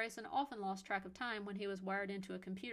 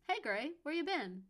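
bright greeting; 6 semitones higher, 5 dB louder than usual. A narrator's reading. Mother answering son's call.

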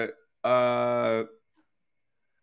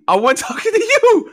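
laughing through the words; 4 dB louder than usual. Real, not acted. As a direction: crack up mid-line.

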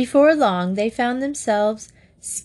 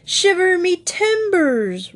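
high, slow bellowing sea captain; roaring old sailor; 11 semitones higher, 3 dB louder, slower than usual. A narrator's reading. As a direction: roar it like an old admiral. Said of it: "roared the Admiral".

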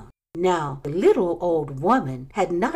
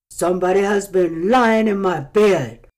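louder gruff big bear; great rough gruff voice; 6 dB louder than usual. A narrator's reading. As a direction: boom like the great huge bear. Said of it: "in his great rough, gruff voice".

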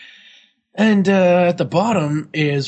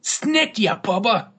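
mock Australian accent; 6 semitones higher, slower than usual.

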